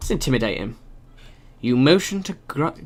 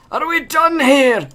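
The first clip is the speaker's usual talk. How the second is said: line redone much higher and slower; a second, higher, drawn-out take of the question; 11 semitones higher, 6 dB louder, slower than usual. Shown as a demonstration. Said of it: "Oh, no".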